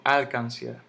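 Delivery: "alcancía" stressed on its first syllable, AL-can-cia; mis-stressed "alcancía" as ÁLcancia; wrong stress on first syllable. Shown as a demonstration. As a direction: hit AL in "alcancía", let the rest fall away. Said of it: "Yo no digo".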